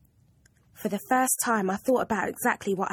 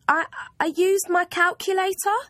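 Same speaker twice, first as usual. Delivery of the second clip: put-on high, hesitant girl's voice; a nervous schoolgirl explaining herself; young schoolgirl; 10 semitones higher, 5 dB louder than usual. Girl pleading with her maths teacher, lightly voiced child.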